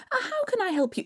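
high nervous greeting; mother. Acted. Mother answers the door to the hag, high and wary. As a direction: greet her warily.

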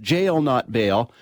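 "Jail, not bail." drawn out slowly, every word weighted; quoting the slogan back, slowly and deliberately; slow slogan stress. Retold or imitated. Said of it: "Poilievre just told me".

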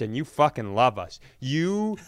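usual speaking voice

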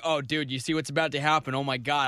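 low, toned-down Canadian-tinged murmur; accidental Canadian accent. Retold or imitated. Imitated fan commenting through the movie, slight Canadian accent. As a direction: mimic a moviegoer with a hint of Canadian. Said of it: "I don't know why he's Canadian".

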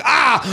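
high mock-Italian yell of disgust; angry "ah!". Gelato owner confronts customer, mock angry cry. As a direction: cry out like an offended shop owner. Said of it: "Anita came out and was like".

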